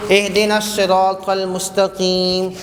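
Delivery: slow melodic recitation; Quranic recitation. Shown as a demonstration. Teacher reciting the verse to the class, slow melodic chant.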